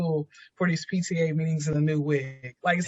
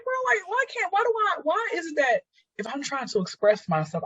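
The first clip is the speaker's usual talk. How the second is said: exasperated complaint; 10 semitones higher than usual. Retold or imitated. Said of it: "I'm like".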